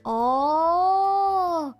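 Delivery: ordinary talk, nothing marked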